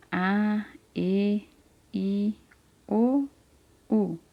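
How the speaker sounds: "A, E, I, O, U" one at a time with short gaps; vowel recitation. Shown as a demonstration. Teacher reminding children of the vowels, each said on its own. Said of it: "Lembra das vogais?".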